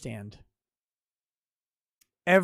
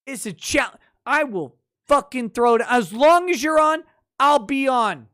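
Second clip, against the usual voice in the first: higher and louder, hammed-up challenge; mock trash talk; 6 semitones higher, 5 dB louder than usual. Streamer daring Mahler to outstream him, hammed-up loud taunt. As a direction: taunt the rival like a wrestler cutting a promo.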